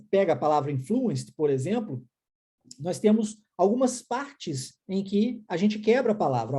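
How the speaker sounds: ordinary talk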